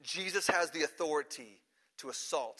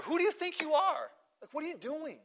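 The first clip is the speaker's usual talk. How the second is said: indignant challenge; 11 semitones higher than usual. Retold or imitated. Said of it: "the leaders are going to ask him".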